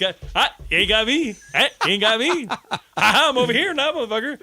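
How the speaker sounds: high, loud laughing taunt; gleeful high sing-song taunt; taunting kid. Retold or imitated. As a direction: play a kid dodging the teacher in the yard. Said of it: "Yeah, like".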